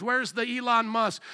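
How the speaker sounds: ordinary talk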